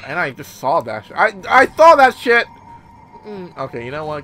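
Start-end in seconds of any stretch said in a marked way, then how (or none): 1.1-2.5 higher, louder repeat; loud insistence. Real, not acted. Player reacting to what he saw, loud insistence. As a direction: insist on it, raised voice.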